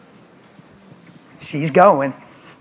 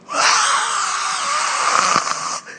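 high unvoiced gasp. Real, not acted.

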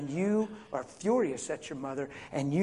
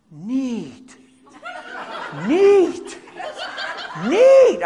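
dazed neat; 9 semitones higher, 9 dB louder, much slower than usual. Retold or imitated. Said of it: "He went".

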